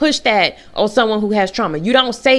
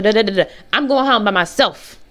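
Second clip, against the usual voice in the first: normal talking voice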